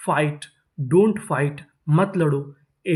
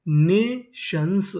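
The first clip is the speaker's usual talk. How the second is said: Nations very slow as ने, शंस; syllable by syllable; much slower than usual. Shown as a demonstration. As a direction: sound out Nations in two parts. Teacher sounding out new word.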